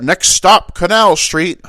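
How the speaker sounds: shouted stop announcement. Retold or imitated. Dad calling subway stops to his kid, shouted. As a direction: call out the stop like a train worker. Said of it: "these places you keep shouting at me".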